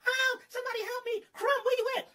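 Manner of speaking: high shrieking cry for help; screaming damsel. A narrator's reading. Boss screaming for her worker, high-pitched. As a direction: shriek for the hero in a falsetto. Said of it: "She screamed and hollered".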